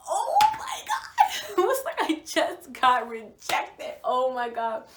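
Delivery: high, loud laughing squeal of disbelief. Retold or imitated. Telling friends about being rejected, squealing with laughter. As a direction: laugh it off, half mortified.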